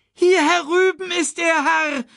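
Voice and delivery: high bird call. A narrator's reading. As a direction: call the king to his son. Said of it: "rief Sasu". (hoher Vogelruf zum König)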